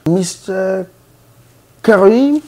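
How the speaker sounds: high, loud call across the waiting room; calling out a name. Retold or imitated. Nurse loudly calling the patient's mangled name.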